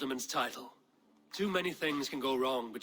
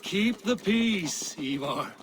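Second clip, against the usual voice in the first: stern warning; 4 semitones higher, 7 dB louder, slower than usual. Acted. Eivor warning Ivar.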